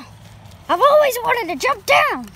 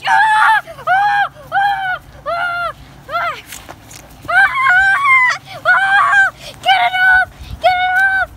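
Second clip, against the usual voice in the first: pained screaming; 10 semitones higher than usual. Acted. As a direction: scream like something is biting you. Attacked, screaming in pain.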